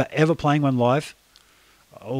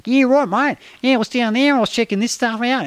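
much higher, louder broad ocker Australian newsreader; mock Aussie newsreader; 10 semitones higher, 3 dB louder than usual. Mocking modern TV newsreaders to the host, broad high Australian accent. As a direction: send up a TV reporter gone full Aussie, pitched high. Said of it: "they're going".

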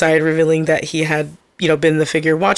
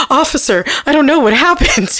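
higher-pitched fake innocence through laughter; mock innocent husband; 9 semitones higher than usual. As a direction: play dumb for the police, giggling. Jokes as the husband to police.

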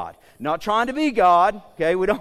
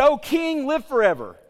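high, raised hail to a monarch; courtiers hailing the king; 6 semitones higher than usual. Acted. Wise men addressing their king, acted high acclaim. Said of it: "So they said".

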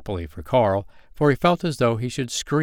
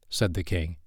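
usual speaking voice

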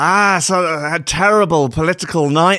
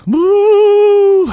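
high, loud spooky wail; a cartoon ghost swooping away; ghostly woo; 8 dB louder than usual. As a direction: ghosts vanishing into the night. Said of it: "they all disappear into the ether".

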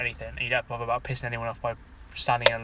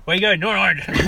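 louder, higher put-on Irish lilt; mock Irish accent; 6 semitones higher, 6 dB louder than usual. Traveller asked his destination, answering in a mock Irish accent. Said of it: "fight the urge".

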